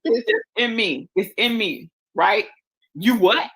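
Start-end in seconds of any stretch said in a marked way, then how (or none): 2.9-3.5 playful New York street voice, repeated yo; mock New York accent. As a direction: ham up a New York greeting. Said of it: "I'm gonna be New York".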